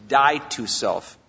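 each word DIE to self laid down slow and heavy, falling on "self"; slow emphatic. Shown as a demonstration. Pastor quoting the Bible to his congregation, slow and emphatic. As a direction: spell out "Die to self." slowly, leaning hard on "Die". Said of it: "Very emphatic".

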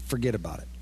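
low New Jersey "forget about it"; tough-guy New Jersey "forget about it"; lightly done New Jersey accent, low and soft. Shown as a demonstration. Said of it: "You just need a New Jersey accent".

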